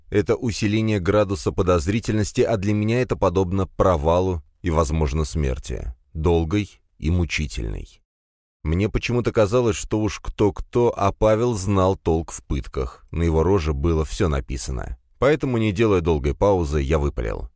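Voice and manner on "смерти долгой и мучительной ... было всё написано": ordinary delivery, nothing marked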